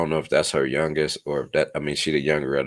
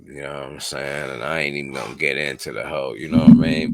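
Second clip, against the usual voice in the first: ordinary talk, nothing marked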